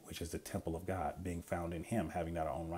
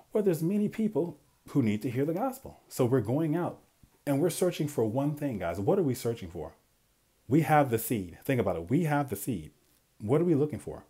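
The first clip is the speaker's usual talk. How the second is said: much louder, higher, driving the point home; fiery preaching; 6 semitones higher, 7 dB louder than usual. Real, not acted. Preacher rousing his viewers to spread the gospel, loud and fervent. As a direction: preach it out with rising fire.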